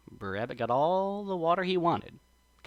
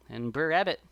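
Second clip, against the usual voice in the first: usual speaking voice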